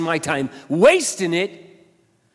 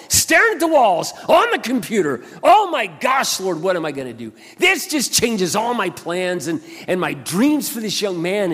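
rising from a hush to a high, loud alarmed plea; panicked prayer; 7 semitones higher, 6 dB louder than usual. Retold or imitated. Pastor praying in panic over the injured young man, high and loud. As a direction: cry out to the Lord in alarm. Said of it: "I struggled with".

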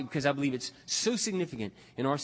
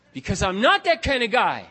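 loud, raised-pitch retold retort; indignant protest; 7 semitones higher, 7 dB louder than usual. Friend insists he would never kill, indignant protest. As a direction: push back as the offended friend, high and loud.

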